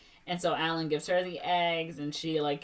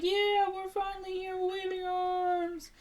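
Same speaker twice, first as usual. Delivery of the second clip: high, drawn-out mock holler; imitated high-pitched cheering; distant calling out; 13 semitones higher, slower than usual. Family yelling to rescuers.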